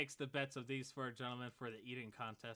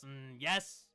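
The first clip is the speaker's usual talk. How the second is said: odd lizard folk voice; 10 semitones higher, 10 dB louder, much slower than usual. Acted. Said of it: "He goes".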